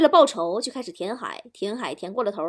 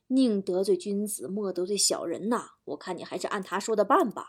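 low, soft, measured counsel; little inner voice advising gently and low; persuading; 5 semitones lower, 5 dB quieter than usual. Acted. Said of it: "另一个小人劝我说".

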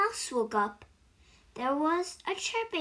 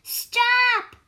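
much higher, much louder cry; 11 semitones higher, 11 dB louder than usual. Acted. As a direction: yell it to stop the noise. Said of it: "cried the mouse".

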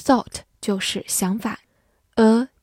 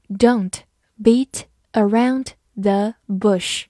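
slow read; slower than usual. Shown as a demonstration. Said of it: "slow then normal repeat".

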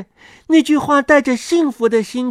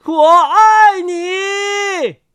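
high, very drawn-out shout; loud shout; 8 semitones higher, 3 dB louder, much slower than usual. A narrator's reading. Words flying over the sea, shouting loudly. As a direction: shout it long and high, holding every vowel. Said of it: "大声地说".

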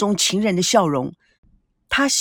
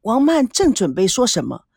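ordinary delivery

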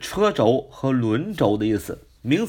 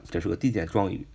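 ordinary delivery

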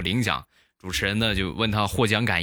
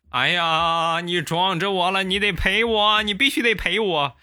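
put-on high, whiny old man's wail; old man crying out that he was hit, pitched high; whining old uncle; 8 semitones higher than usual. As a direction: wail up high like a scammer playing hurt. Old uncle fakes accident whining.

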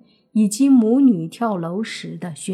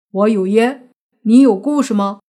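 calling out; 8 dB louder than usual. Acted. Calling out to the whole house.

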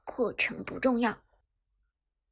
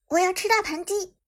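excited; 8 semitones higher, 4 dB louder than usual. Acted. Eyes light up at food.

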